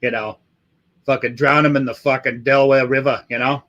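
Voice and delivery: put-on Boston tough talk; Boston accent with dropped Rs. Shown as a demonstration. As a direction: riff in a broad Boston accent. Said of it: "doing fucking Boston fucking accents".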